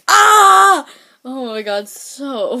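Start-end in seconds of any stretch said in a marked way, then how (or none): 0.1-0.9 high, loud mock scream. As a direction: shriek it like the clip. Imitating a funny clip moment.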